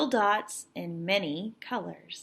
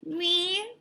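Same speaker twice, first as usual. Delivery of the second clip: put-on higher, doubtful little-boy "me"; surprised little boy; 8 semitones higher, 3 dB quieter than usual. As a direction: sound startled that anyone believes in you. Boy doubts he can draw.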